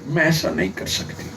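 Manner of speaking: a mother's helpless confession, sinking away; tearful mother. Retold or imitated. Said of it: "رو پڑی، کہا".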